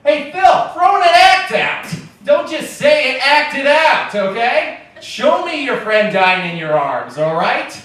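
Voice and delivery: high, loud mock heckle from the corner; yelled advice from the back of the room; heckling Phil. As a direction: coach Phil like a pushy director. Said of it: "in the corner".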